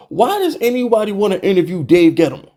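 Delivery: ordinary talk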